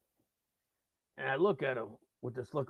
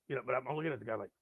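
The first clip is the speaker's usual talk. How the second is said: ordinary talk, nothing marked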